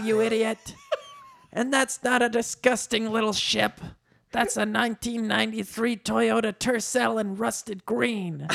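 high, indignant put-on voice; high comic character, proudly defensive; Danny DeVito. A narrator's reading. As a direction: brag indignantly as an offended little man.

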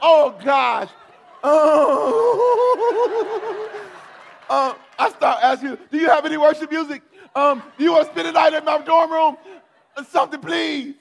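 high, loud frantic pleading; panicked begging. Retold or imitated. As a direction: beg like you're terrified. Retold panic after the vision.

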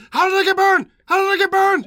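high, loud hammed-up scream; screaming impression. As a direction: imitate an actor's unhinged screaming. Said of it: "and he just screams".

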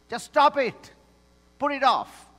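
high, loud and firm; blunt command. Acted. Pastor voicing Paul, ordering the church to stop: loud, high command. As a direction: snap them out of it.

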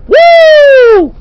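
very high, loud wrestler woo; Ric Flair woo. Retold or imitated. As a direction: let out a big wrestling-promo woo. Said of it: "That's Ric Flair".

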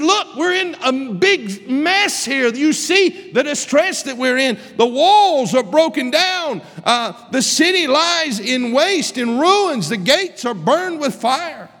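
high, loud, slow pulpit cry; impassioned preacher voicing Nehemiah; full-force preaching. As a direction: proclaim it like a sermon climax.

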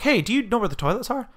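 polite stranger asking. Retold or imitated. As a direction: imitate a stranger asking directions. Voices woman asking for directions.

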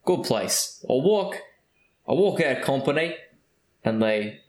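a hint of a British accent, mostly Australian; a lightly held British accent; light mock British accent. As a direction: keep the drunk Brit's accent going lightly. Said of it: "your British accent is just Australian".

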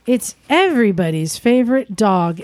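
ordinary talk

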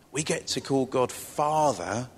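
ordinary delivery

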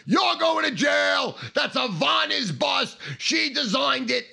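slow, high, loud scolding; threatening shout. Retold or imitated. Guards tell the culprit he's caught, shouted threats. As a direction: yell each accusation drawn out.

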